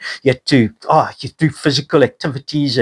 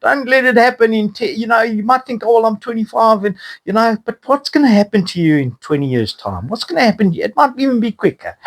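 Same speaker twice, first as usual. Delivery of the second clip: urging youngsters; 7 semitones higher than usual. Retold or imitated. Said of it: "I'm trying to say".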